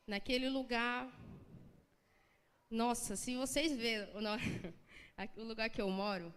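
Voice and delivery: normal talking voice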